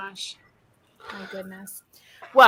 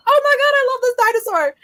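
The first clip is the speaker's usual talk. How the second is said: high, loud gush of love; gushing delight; 5 dB louder than usual. Retold or imitated. Fan seeing the brontosaurus fed, gushing high. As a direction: squeal it like a fan. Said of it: "I'm like".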